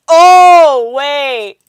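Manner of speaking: very loud, high, drawn-out outburst; shouted realisation. Real, not acted. Streamer grasping a chat compliment, loud astonished shout. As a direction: yell it as it suddenly hits you.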